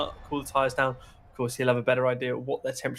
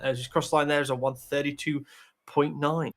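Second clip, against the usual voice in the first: normal talking voice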